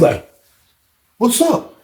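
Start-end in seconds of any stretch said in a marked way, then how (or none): 1.2-1.7 surprised. Retold or imitated. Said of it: "he was surprised to see me".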